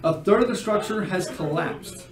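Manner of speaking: atmospheric scene narration. Acted. Game master revealing a silent ruined house, atmospheric narration. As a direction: build atmosphere with pauses, then drop away.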